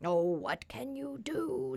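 high, slow whining pig; tearful pig. A narrator's reading. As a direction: whine it slowly, close to tears. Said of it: "beginning to cry again".

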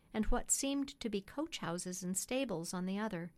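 plain reading voice

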